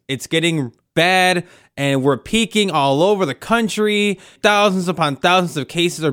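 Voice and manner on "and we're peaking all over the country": normal talking voice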